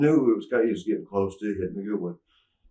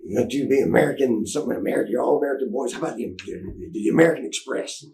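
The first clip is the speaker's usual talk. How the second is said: lightly voiced exasperated promoter; 3 semitones higher than usual. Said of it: "he was".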